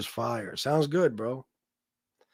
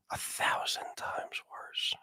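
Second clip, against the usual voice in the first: slow, breathy voiceless whisper; menacing whisper; 16 dB quieter, slower than usual. Acted. Streamer whispering the threat again to his viewers, slow menacing whisper. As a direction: hiss it slowly like a secret threat.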